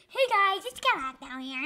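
high, loud hammed-up kid YouTuber greeting; mock your old cringe intro. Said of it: "those days when I said".

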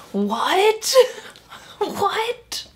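a high, disbelieving what, rising up; shocked what. Real, not acted. Reacting to a creepy book line, stunned and incredulous.

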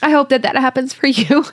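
fighting back tears while sharing; real, tearful tenderness; emotional. Real, not acted. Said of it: "I didn't want to get emotional about this".

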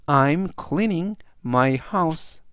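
very slow, careful English reading; slow model sentence. Shown as a demonstration. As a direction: spell out each word slowly for students. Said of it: "전체적 의미는". (아주 천천히 또박또박 읽는 예문)

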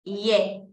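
"Ye" cut short, the wrong Spanish-style sound. Shown as a demonstration. Teacher shows the class the mistaken "Ye". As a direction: say "Ye" the way students get "yellow" wrong. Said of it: "No es ye".

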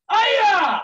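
loud, high karate cry; explosive "aya" battle cry; martial arts yell. Shown as a demonstration. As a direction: let out a piercing kiai. Martial arts shout as song chorus.

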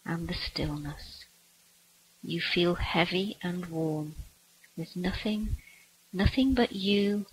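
usual speaking voice

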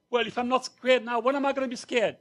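high, loud panicked punchline; mock panic. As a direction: finally crack with fear. Punchline, boy finally panics.

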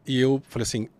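ordinary talk

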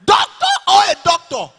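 high, loud mock-mother shout; hammed-up Nigerian mother yelling; imitating shouting mother. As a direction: yell it like an outraged mom. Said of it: "My own mother".

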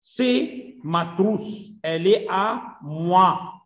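very slow, word by word French; slow dictation reading. Shown as a demonstration. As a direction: spell it out slowly for students. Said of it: "teacher reads French sentence". (قراءة فرنسية بطيئة كلمة كلمة)

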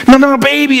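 high, insistent correction; excited angel. Acted. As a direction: insist in a high, eager pitch. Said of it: "this angel that's just so excited about this event".

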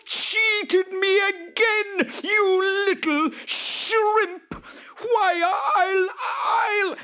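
very high, loud furious shriek; screaming with rage. Acted. Cheated Boggart shrieking at the farmer in rage. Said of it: "The Boggart screamed with rage".

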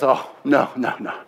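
low, softening denial; gentle reassurance. Acted. God answering people who condemn themselves, gently reassuring.

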